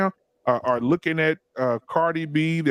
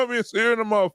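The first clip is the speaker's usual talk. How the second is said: Bernie Mac; 6 semitones higher, faster than usual. Retold or imitated. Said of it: "turned straight into Bernie Mac".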